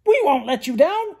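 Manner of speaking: high, loud eager cry; owl shouting. A narrator's reading. Screech Owl shouting to the group, high and loud. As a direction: shout it like a keen little owl. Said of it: "Screech Owl shouted".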